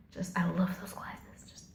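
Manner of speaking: whispered, very soft gushing; swooning near-whisper. Real, not acted. Vlogger gushing over an actor's glasses, in a swooning whisper. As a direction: drop to an admiring whisper.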